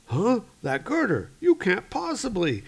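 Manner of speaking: high-pitched disbelief; incredulous, voice jumping up; Arnie. Acted. Trapped man amazed at the girder lift, high and incredulous. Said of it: "Arnie's like".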